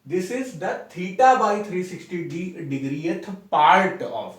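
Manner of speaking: very slow, each word spaced for note-taking; slow dictation. Shown as a demonstration. Teacher dictating a definition to students, very slow and even. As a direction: spell it out slowly so students can write.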